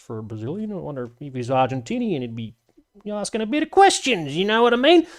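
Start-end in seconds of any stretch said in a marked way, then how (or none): 3.1-5.1 a high, put-on voice, asking; mock accent. Joking to chat about the actor's name in a high mock voice. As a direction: slip into a silly foreign voice for the joke.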